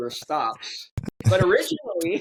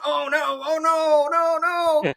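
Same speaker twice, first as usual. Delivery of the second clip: high mock-dismay "oh no", rising to louder; dismayed groans; 10 semitones higher, 4 dB louder than usual. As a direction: wail it like your money fell down the hole. Man in outhouse losing money.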